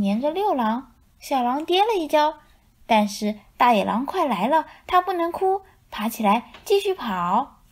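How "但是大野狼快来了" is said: ordinary delivery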